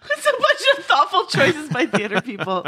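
laughing through the line; cracking up while repeating the review; quoting a favourite review through real laughter. Real, not acted.

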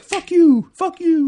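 high, loud drawn-out yell; retold defiant shout. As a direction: shout it back at the bouncers as you leave. Group shouting at bouncers while leaving.

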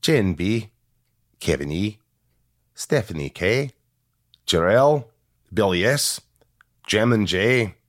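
names read out in a hammed-up Irish accent. Host reads listener names in a mock Irish accent.

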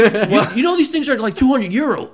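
high, loud mock scolding lady; irritated lady. Coffee shop lady scolding a confused tourist, high and loud. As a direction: play the annoyed staff lady catching a fool.

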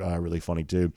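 ordinary talk, nothing marked

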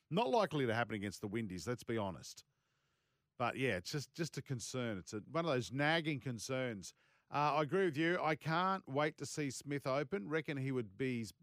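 ordinary talk, nothing marked

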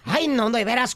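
ordinary delivery

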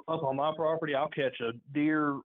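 ordinary talk, nothing marked

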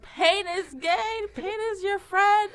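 high, slow, drawn-out coach yell; yelled motto. Acted. Instructor answering class's complaints, high drawn-out yell.

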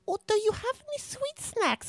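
very high-pitched mimicked teacher's plea; imitated Russian teacher. Teacher asks pupil once stepmother leaves the room, high mimicked voice. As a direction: put on a high teacher voice, angling for treats. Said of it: "my Russian teacher was like".

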